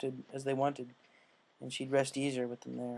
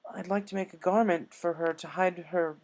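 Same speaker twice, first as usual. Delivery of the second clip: higher voiced elegant unicorn pleading; earnest Rarity; 5 semitones higher than usual. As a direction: plead sincerely to royalty.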